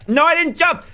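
high-pitched cry of disbelief; frustrated high yelp; exasperated protest. Real, not acted. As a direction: protest the miss, voice leaping up. Protests a failed jump.

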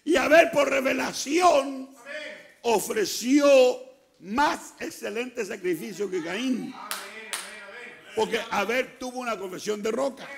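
loud, high preacher's cry, pressing each phrase; full-force preaching. Acted. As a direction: preach it hard to the congregation. (predicación a todo pulmón)

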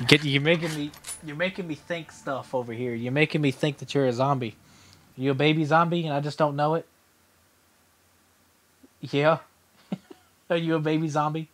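soft, playful questioning. Real, not acted. Teasing the child behind him.

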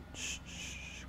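soft, hushed "shh, shh"; soothing double shush. Acted. Boyfriend asking crying partner what happened, soothing shush. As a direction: gently hush someone who is crying.

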